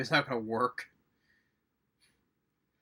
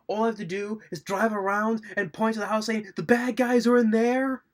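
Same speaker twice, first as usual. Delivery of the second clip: high, incredulous retelling, building louder; mock exasperation; 8 semitones higher, 5 dB louder than usual. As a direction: pitch it high, stretch the end out loud. Acting out an exasperated quote.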